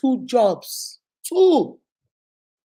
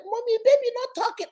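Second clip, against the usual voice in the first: put-on high little girl voice; child voice; 13 semitones higher than usual. Toddler telling mommy the baby won't talk, high child voice. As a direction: play the toddler complaining. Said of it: "She said".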